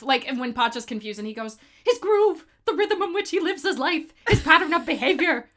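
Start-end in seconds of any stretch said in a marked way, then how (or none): 1.8-5.5 high, urgent old man impression; frantic old man. Friend reciting old man's speech to confused Pacha, frantic high voice. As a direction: explain it in a high, worked-up voice.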